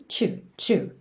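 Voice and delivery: "to" said as an isolated "chu"; linked T and you. Shown as a demonstration. Teacher isolates the junction for learners, ch sound. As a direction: give the joined sound "to" as a short "chu". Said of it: "junção aí de T com you".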